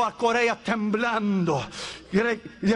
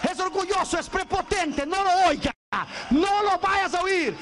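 frantic pleading; 7 semitones higher than usual. Retold or imitated. Proud man begs God in panic.

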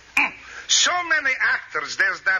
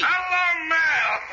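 usual speaking voice

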